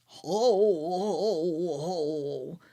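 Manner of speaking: a lightly voiced jolly Santa chuckle; Santa laugh.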